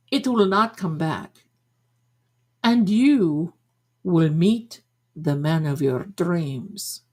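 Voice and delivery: put-on deliberate Madame Blavatsky accent with long pauses; fake psychic accent. Psychic promising the man of your dreams, fake accent. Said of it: "mine is fake".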